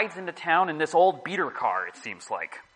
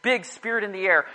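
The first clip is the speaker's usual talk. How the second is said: usual speaking voice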